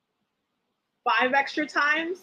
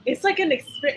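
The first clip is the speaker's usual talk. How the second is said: normal talking voice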